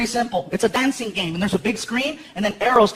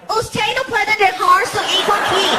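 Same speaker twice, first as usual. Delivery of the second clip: wag the finger with a chant of allá sí, aquí no; sassy singsong shouted telling-off; principal in Spanish; 7 semitones higher than usual. Retold or imitated. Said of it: "she was like".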